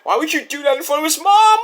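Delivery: high-pitched incredulous question; mock dismay. Fan to Copeland about hitting him before his mom, mock dismay. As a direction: climb high in mock disbelief.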